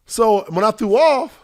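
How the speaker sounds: normal talking voice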